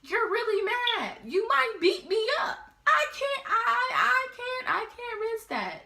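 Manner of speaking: high, loud, frantic whine; mock panicked pleading. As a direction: plead in a scared squeaky voice. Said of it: "I'm like".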